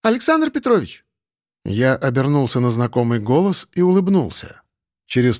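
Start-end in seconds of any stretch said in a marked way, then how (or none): 0.0-1.0 high-pitched hail to catch someone; high, joyful call of his name. A narrator's reading. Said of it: "Но меня окликнули".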